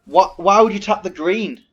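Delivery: very loud, high angry shout; furious yell. Real, not acted. Streamer yelling at his auto tapper, loud high fury. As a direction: explode at the game.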